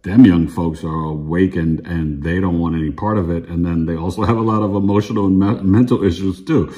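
normal talking voice

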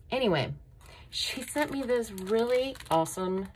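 ordinary talk

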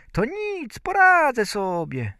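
put-on very high little-boy voice; Bździelik. A narrator's reading. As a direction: assure mother in a tiny high voice. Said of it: "Bździelik rzekł". (wysoki głosik malca)

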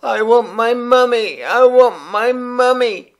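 put-on high, loud wailing child; a lost little boy screaming for his mum; yelling for mummy. A narrator's reading. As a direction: bawl it out like a lost toddler. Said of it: "yelling at the top of his voice".

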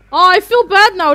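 real distress at hurting them; guilty outcry. Real, not acted. Feels guilty catching fairies.

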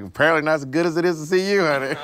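fast, shady, sweet put-down; sassy drag queen. Retold or imitated. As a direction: throw fast sugary shade. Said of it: "I'm like".

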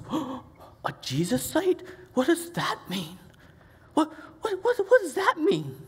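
high baffled questioning; puzzled mom. Retold or imitated. Mom asking what the taunt means, high and puzzled. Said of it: "And my mom goes".

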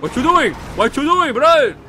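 loud and sharp, calling across the street; sudden shout. Acted. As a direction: shout at strangers near his cop car. Shouting at people near his car.

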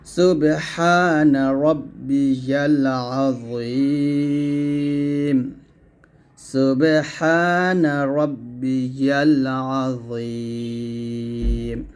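সুবহান রব্বিয়াল আযীম stretched very long; model recitation. Shown as a demonstration. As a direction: chant it slowly, আযীম held long. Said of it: "এরকম". (অনেক ধীরে টেনে আযীম)

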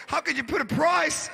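high-pitched tearful protest, hammed up; mock crying. Upset woman protesting the price at the vet, mock crying. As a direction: wail it in mock distress. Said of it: "She was crying".

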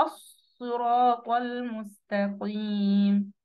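very slow, sustained "الصراط المستقيم", heavy ص, ط and ق; emphatic recitation. Shown as a demonstration. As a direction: chant "الصراط المستقيم" drawn out with full emphatic letters. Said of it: "يبقى إيه".

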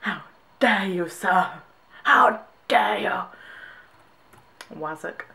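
loud, drawn-out indignation; mock outrage. Brit defending British slang to an American, mock outrage. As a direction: play grandly offended, leaning hard on DARE.

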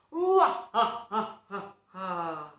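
loud falling villain laugh; evil cackle. Acted. Evil pea cackling, loud falling laugh. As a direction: cackle like a baddie.

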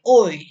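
Oi with pushed-out lips, loud; pouted oi. Shown as a demonstration. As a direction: pout the lips for Oi. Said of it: "Jadi kayak moncong gitu". (Oi dengan bibir monyong)

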